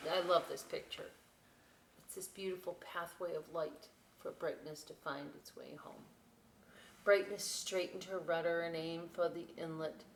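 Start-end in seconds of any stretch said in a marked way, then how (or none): none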